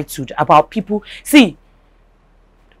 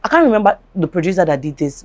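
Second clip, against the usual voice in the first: ordinary talk, nothing marked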